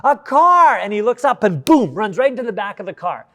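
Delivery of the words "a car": raised, louder shout of alarm; retold warning yell. Father warning his son about a car, retold yell. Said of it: "I yell at him".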